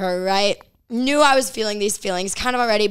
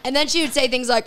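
ordinary talk, nothing marked

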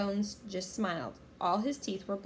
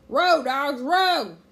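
very loud, high pirate yell; bellowed order; 7 semitones higher, 12 dB louder, much slower than usual. A narrator's reading. Captain Bones driving his rowers, loud bellow. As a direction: bellow it across the boat.

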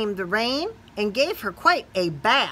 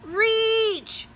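called out; 13 semitones higher than usual. Acted. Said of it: "called the beetle".

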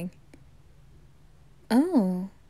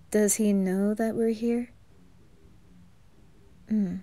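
normal talking voice

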